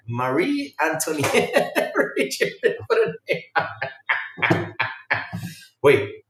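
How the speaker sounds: ordinary talk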